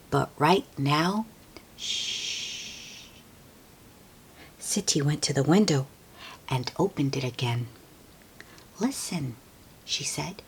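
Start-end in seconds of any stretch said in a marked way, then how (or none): 1.8-3.1 long quiet shh; grandma hushing an excited child; soft shush. Acted. 8.8-10.4 softer unvoiced storytelling; whispered narration. A narrator's reading. Story hush near window.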